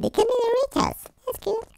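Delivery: ordinary delivery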